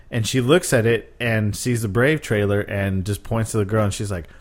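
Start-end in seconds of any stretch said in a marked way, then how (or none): none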